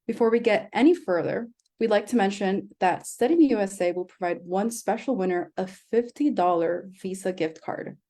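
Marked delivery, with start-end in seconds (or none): none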